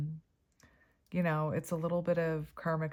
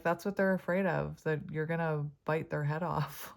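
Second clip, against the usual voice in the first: ordinary talk, nothing marked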